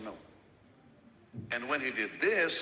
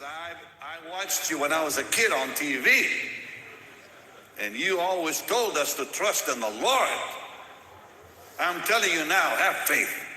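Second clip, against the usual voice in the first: raised, earnest plea to keep faith; earnest loud urging; 4 semitones higher, 5 dB louder than usual. Retold or imitated. Male nurse urging the sick preacher to have faith, loud and earnest. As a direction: urge him hard to trust the Lord.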